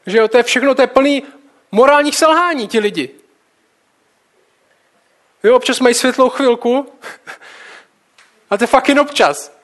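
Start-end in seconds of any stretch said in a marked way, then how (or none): 5.4-9.6 higher, louder, with a real laugh; laugh, then land the wry aside; amused. Real, not acted. Preacher joking about flawed biblical people, laughing.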